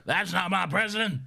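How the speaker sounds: high mock protest yell; outraged Republican. Friend imitating Republicans rejecting anyone else, mock outraged yell. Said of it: "even a Republican, they're like".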